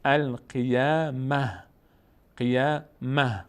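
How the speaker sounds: القیامه very slow, ending in a breathed h; pausal he ending. Shown as a demonstration. Tajweed teacher shows stopping on the word, pausal h.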